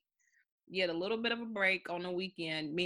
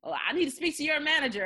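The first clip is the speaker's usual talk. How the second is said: higher, louder, fast demanding customer; mock angry customer; 8 semitones higher, 7 dB louder, faster than usual. Imitating an angry customer demanding the manager. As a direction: huff it like an entitled caller. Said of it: "She was mad too".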